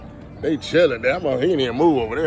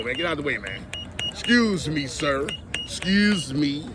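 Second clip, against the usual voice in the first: usual speaking voice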